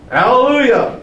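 high, ringing hallelujah; praising hallelujah. Retold or imitated. Preacher voicing the great multitude, exultant. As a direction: call it out like thunder from heaven.

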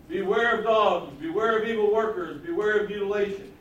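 loud, driving list of three warnings; emphatic quoted warning. Retold or imitated. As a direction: hammer out each warning forcefully. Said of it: "here's how he lays it out".

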